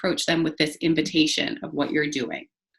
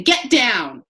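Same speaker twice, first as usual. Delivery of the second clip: put-on sharp raised "Get down!" barked at a child; harsh command; 5 semitones higher, 4 dB louder than usual. Shown as a demonstration. Teacher scolding a climbing child, harsh bark. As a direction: snap it as the wrong way. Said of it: "There's a big difference there than".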